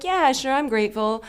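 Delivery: high, loud, glib mock agreement; breezy lip service. Imitating people who throw forgiveness around, glib and high. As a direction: toss it off as empty, sing-song assurance. Mimics people claiming gratitude casually.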